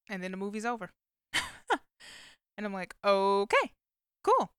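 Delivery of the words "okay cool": mock surprise. Reacting to abrupt movie ending.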